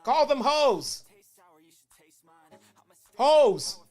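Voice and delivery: hammed-up commentator demanding the last word; mock indignant rant. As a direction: commentator scolding his partner on air. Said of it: "King was not fucking playing".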